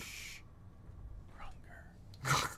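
breathy hushed whisper. Acted. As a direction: whisper it like a secret. Hushed aside in review.

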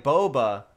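BOBA said with a long O, BOH-buh; trying another pronunciation. Shown as a demonstration. Streamer checking with chat, BOBA as BOH-buh. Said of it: "Is that how you say it?".

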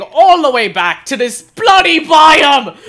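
high, loud, slow exasperated yell; frustrated shout. Real, not acted. Player fed up with travelling back, frustrated shout. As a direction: drag out the words in loud exasperation. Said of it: "this is just so frustrating".

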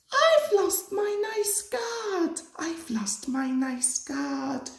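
a coach pretending to weep for her guard; mock sob. A narrator's reading. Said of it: "she sobbed".

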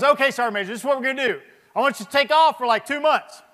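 hammed-up bossy therapist voice; imitating the therapist. Imitating therapist's brisk orders.

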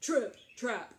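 loud, weighty stomps; heavy big footsteps. Acted. Very big billy goat crossing the bridge, loud and heavy. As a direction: land each word like a huge hoof. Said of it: "Remember, he's very big".

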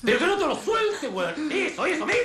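high, excited yelling; frantic shouted orders. Acted. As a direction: keep screaming commands over the struggle. Sigue dando órdenes a gritos. (órdenes agitadas a gritos)

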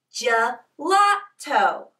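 "gelato" slowly, with a gap after each syllable. Shown as a demonstration. Teacher saying "gelato" along with children, slowly syllable by syllable. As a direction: lead "gelato" in three slow, separate beats. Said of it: "Can you say it with me?".

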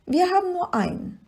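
high-pitched small creature voice; kleines Monster. A narrator's reading. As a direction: answer high and childlike. Little monster voice, answering in wonder. (hohe kleine Monsterstimme)

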